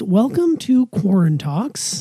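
slow drawn-out welcome. Acted.

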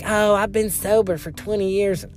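put-on Southern accent. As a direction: imitate a Southern old-timer in a meeting. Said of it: "make everyone have a Southern accent".

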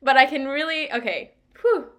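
high, loud, teary laughter; real emotional laugh-cry while confessing she's about to cry. Real, not acted. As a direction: laugh to hold back tears. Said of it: "I'm gonna cry".

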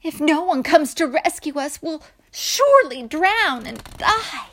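high, loud despair; dramatic, panicky lament; frightened crying out. A narrator's reading. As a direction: wail it as doomed sailors. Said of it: "they cried".

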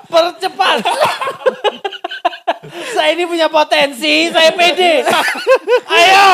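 laughing. Acted. Pleading mock job applicant.

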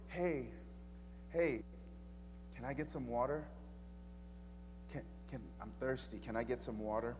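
very soft, hushed asking; gentle request. Retold or imitated. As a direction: ask softly, almost under the breath. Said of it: "he goes".

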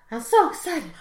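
imitating an African accent, excited; a happy business partner's accent, lightly put on; Selassie's African accent. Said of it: "Selassie just has a very distinct".

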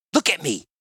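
fierce command. Acted. Woman orders the goblin in her grip, fiercely. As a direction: demand attention with a sudden burst.

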